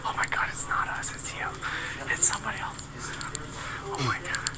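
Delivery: hushed panicked whisper. Real, not acted. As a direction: whisper it nervously. Whispering in hallway.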